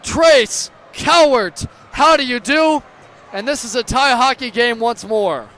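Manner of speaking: screaming the goal, very high; stretched, roaring goal call; goal call shout. Real, not acted.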